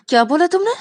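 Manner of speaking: sharp, high, loud demand; challenging. Acted. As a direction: dare him to repeat it. Rani challenges again. (गुस्से में चुनौती)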